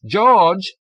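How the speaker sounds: loud call across the room. A narrator's reading. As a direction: call him over. Calling the guard.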